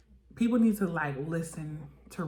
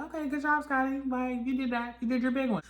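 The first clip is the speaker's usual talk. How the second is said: high praise; 8 semitones higher than usual. Real, not acted. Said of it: "I was just like".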